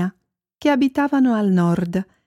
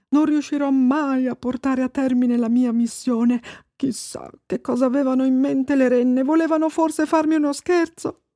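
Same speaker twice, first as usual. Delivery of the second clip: higher-pitched anxious musing; worried, short of breath; 5 semitones higher than usual. A narrator's reading. Babbo Natale wondering about the missing reindeer, worried. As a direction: fret aloud to yourself. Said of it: "Aveva il fiato corto ed era molto preoccupato".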